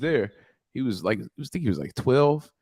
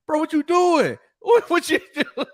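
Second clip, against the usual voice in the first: much higher, half-laughing disbelief; mock outrage; 4 dB louder than usual. Uncle scolding nephew over beat-up shoes, high laughing disbelief. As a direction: squeal it in amused shock at the ruined kicks.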